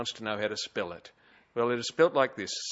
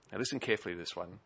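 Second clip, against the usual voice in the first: hushed aside; 6 semitones lower, 9 dB quieter, much faster than usual. Real, not acted. Aside before punchline.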